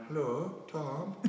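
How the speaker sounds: put-on high-pitched, botched Irish accent that sounds Indian; Paul O'Connell. Rugby player greeting someone in the toilet, in a botched Irish accent. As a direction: imitate an Irish teammate, accent drifting towards India. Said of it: "what part of India is he from?".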